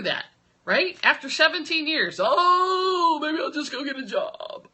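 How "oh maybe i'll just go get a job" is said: high, whiny, half-crying mock complaint; mock wail of despair. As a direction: wail it like a defeated owner giving up. Owner doubting, wailing mock.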